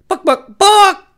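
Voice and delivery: shrill high squawking chicken cry. Acted. Telling a joke, the chicken squawks loudly. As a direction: squawk it out like a hen.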